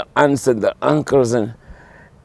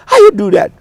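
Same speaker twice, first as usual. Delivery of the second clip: much higher, wondering questions; amazed relatives; 4 dB louder than usual. Retold or imitated. Relatives asking how he makes clicks, much higher voice. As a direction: marvel at the trick.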